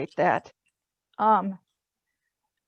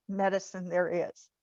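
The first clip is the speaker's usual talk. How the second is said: normal talking voice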